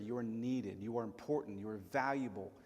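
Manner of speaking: ordinary talk, nothing marked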